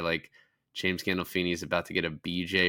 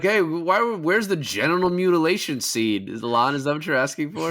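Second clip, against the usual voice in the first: high, loud mock demand; mock-voicing a friend's demand; mocking whine; 8 semitones higher, 6 dB louder than usual. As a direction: poke fun at him by putting words in his mouth. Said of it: "You're like".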